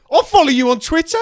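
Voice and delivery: mock-aggressive shout, pitched high. Passer-by calling out to the podcaster, aggressive. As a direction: bark it loudly, imitating an aggressive passer-by. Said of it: "It was very aggressive".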